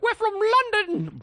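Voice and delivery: put-on very high, drawn-out drunk Londoner; mock London accent. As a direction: imitate a drunk London woman, slow and squealed. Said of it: "She's like".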